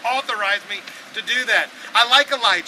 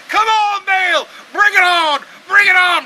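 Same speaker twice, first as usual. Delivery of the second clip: much higher, louder shouted pleas; crowd yelling; 11 semitones higher, 12 dB louder, slower than usual. Retold or imitated. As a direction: yell at a silent god to answer. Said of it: "they're yelling to him".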